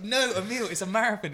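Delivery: high, loud mock protest; protesting coach. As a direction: object loudly as an exasperated coach. Coach objecting to Emil's plan.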